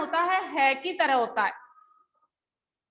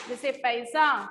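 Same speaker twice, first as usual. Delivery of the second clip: normal talking voice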